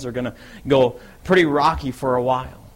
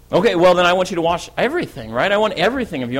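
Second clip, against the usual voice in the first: louder, higher, overeager plea; eager insistence; 5 semitones higher, 4 dB louder, faster than usual. Acted. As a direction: burst out wanting all of it. Peter overeagerly reverses his refusal.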